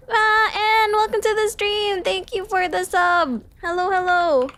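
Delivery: high, delighted squeal of welcome; excited greeting. Real, not acted. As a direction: greet a new subscriber in a high, thrilled voice. Streamer greets a new subscriber.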